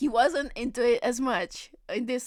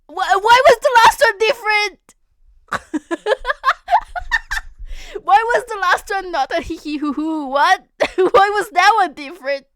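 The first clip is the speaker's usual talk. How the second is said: loud laughing disbelief; cracking up, half shouting the question; laughing hard at the chatbot; 10 semitones higher, 12 dB louder than usual. Real, not acted. Streamer laughing at AI.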